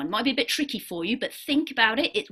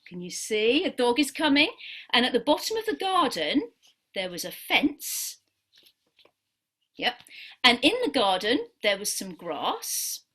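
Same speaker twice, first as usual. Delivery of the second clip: story narration; 3 semitones lower, 4 dB quieter than usual. Acted. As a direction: tell it gently, like a picture story. Tells the dog-drawing story.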